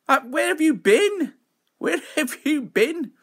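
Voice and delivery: high-pitched, exaggerated swooping question; excited mock demand. Streamer to a returning regular, high mock-indignant cry. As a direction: playfully scold a long-lost pal.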